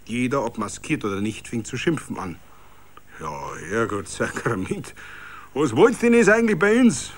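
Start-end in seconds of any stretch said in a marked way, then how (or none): 3.2-7.1 angry shout. A narrator's reading. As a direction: curse and explode at them. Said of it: "schrie ... zornig".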